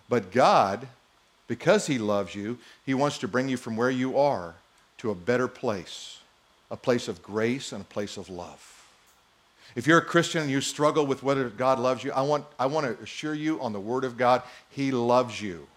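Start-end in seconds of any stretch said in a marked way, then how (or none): none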